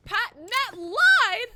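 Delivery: high, drawn-out loud cry; outraged shout. Real, not acted. Player accusing a viewer, outraged shout. Accusing chatter Matt of lying.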